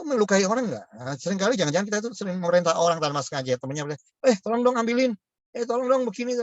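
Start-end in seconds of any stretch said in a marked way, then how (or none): 4.3-6.3 mock-bossy request, pitched much higher. Friend ordering a peer around, bossy. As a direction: imitate a pushy friend ordering someone around. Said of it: "sok ngatur gitu ya".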